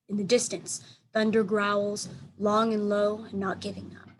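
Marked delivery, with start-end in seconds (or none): none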